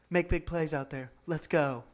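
quiet, limp pep talk without raising the voice; soft halftime speech. Retold or imitated. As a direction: imitate a leader who never raises his voice. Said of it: "in a very, like, soft voice".

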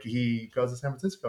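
ordinary talk, nothing marked

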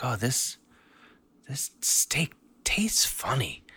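breathy whispered high voice of a sister; whispered sister quote. Retold or imitated. Sister whispering that the steak tastes funny. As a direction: whisper the complaint, disgusted.

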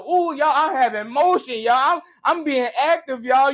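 high, mocking "ooh y'all, I'm having motion"; mock boast of a busy-but-aimless hustler; mocking a show-off. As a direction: lampoon someone bragging about being busy. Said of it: "You stunting all that there".

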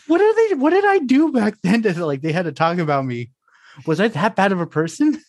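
high, startled self-quote, half-laughing; mock alarmed disbelief. Telling podcast host how he reacted, mock alarmed. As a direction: act out your own panicked reaction.

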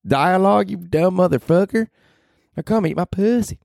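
very high falsetto nagging; scolding mom figure. Acted. Mom figure telling off Danny for mocking Aaron Sorkin, shrill-high scolding. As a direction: scold in a squeaky high put-on voice.

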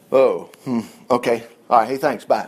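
low, deflated phone replies; discouraged. Acted. Preacher acts out getting a bad phone call, discouraged. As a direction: sink into a subdued mock resignation. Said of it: "I get discouraged pretty quick".

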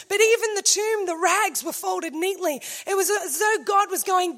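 ordinary delivery

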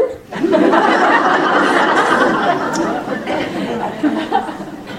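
high, delighted burst of laughter; real laugh. Real, not acted. Laughing at her own joke.

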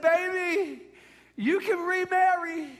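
high, drawn-out mock-tragic plea; hammed-up dying farewell. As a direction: release your wife with slow, melodramatic sorrow. Mock dying farewell to his wife.

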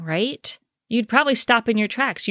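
usual speaking voice

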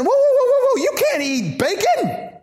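high, loud whoa whoa, halting them; mock alarmed shout. Visitors scolding the Philippian church, mock alarmed shout. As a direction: shout them to a stop over the bacon. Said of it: "they start saying".

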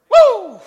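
high, loud "woo"; whoop. Real, not acted. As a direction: let out a real whoop after the big climax. Preacher whoops after climax.

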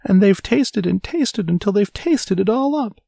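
tiny bear, very high squeal; squealing baby bear. A narrator's reading. Baby bear whose porridge is all gone, high squeal. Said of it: "squealed the baby bear".

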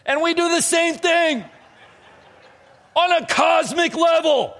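loud, high, emphatic sermon climax; full-force preaching. Real, not acted. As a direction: hammer the point home to the whole room. Drives the point home loudly.

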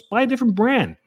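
ordinary talk, nothing marked

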